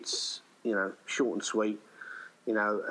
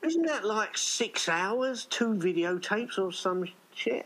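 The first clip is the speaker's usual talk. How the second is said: very high, incredulous whine; imitating young self; 12 semitones higher, slower than usual. Young [name] balking at the long videotape, very high mock whine. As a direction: squeak it out like a doubtful kid. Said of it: "And I'd go".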